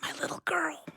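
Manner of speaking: soft, breathy whisper of love; tender whisper. Acted. Dad hugging his daughter, choked soft whisper. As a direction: hold her close and whisper it.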